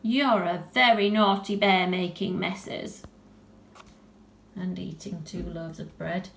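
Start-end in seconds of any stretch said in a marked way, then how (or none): 0.0-3.0 put-on a girl telling off her naughty bear, louder; cross little girl voice, slowing at the end; little girl scolding. Girl Tilly tells off the bear, cross scolding.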